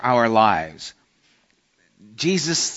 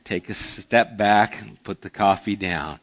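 calming, laughing; 6 semitones lower than usual. Retold or imitated. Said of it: "I was like".

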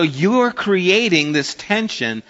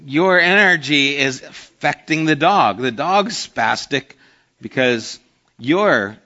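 ordinary delivery

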